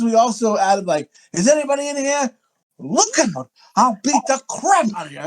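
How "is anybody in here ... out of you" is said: high, flamboyant mock threat; over-the-top movie quote. Kids' inside-joke version of a movie line, over the top. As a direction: ham it up in a raised theatrical pitch. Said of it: "it's so over the top".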